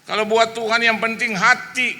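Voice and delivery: high, loud indignant granny; imitated huffy old churchgoer; angry retort. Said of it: "dia marah".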